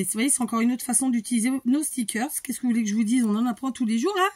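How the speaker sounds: ordinary talk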